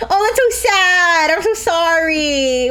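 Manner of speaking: slow, high, syrupy mock pity; hammed-up sorry-for-you voice; fake sympathy. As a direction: coo it with exaggerated pity. Mocking fake sympathy again.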